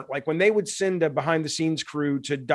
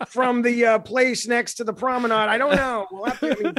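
higher and louder hammed-up entertainment-news voice; mock gossip reporter; 6 semitones higher, 4 dB louder than usual. Joking to the host about TV coverage of game devs, hammed-up gossip reporter. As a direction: narrate a mundane lunch like breathless tabloid TV, half-laughing.

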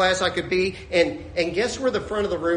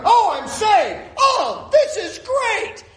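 high, thrilled exclamation, acted out; overjoyed; 12 semitones higher, 4 dB louder, much slower than usual. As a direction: whoop it like a man just healed. Acting healed leper rejoicing.

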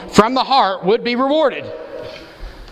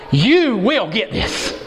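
YOU stretched long and high, each word slow; slow, emphatic promise with a heavy YOU; 4 semitones higher, much slower than usual. Acted. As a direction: lean hard on YOU, drawing it out.

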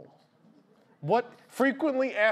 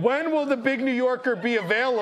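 higher, louder hammed-up customer; hammed-up caller; mock customer question; 6 semitones higher, 4 dB louder than usual. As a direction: read the FAQ question like an eager caller. Acting out FAQ questions.

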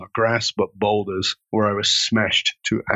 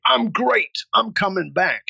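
forced cheerful; 8 semitones higher than usual. Retold or imitated. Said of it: "my jaw was wired closed".

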